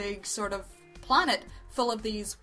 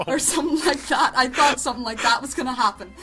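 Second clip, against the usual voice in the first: louder, laughter breaking into the words; laughing while talking; 2 semitones higher, 9 dB louder than usual. Real, not acted. Reviewer laughs at her own plot guess, laughing loudly. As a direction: laugh through the admission, loud.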